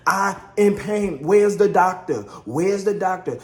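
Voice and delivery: loud mock wail for help; imitated pained complaint. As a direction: demand help like a wife in labour. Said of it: "She kept talking about how much pain she was in".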